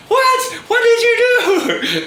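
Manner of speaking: very high, loud mock shriek; hammed-up squeal of surprise; shocked auntie. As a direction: imitate an aunt squealing in disbelief. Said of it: "like, surprised".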